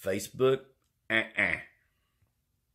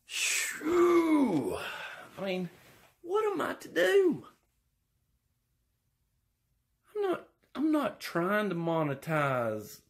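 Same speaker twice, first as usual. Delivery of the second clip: high, put-upon whine; pleading, high complaining voice; whiny high voice; 13 semitones higher than usual. Acted.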